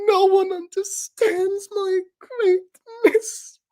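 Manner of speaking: high wailing theatrical woe; melodramatic lament. Acted. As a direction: wail it like a tragic diva. Dramatic self-pity bit.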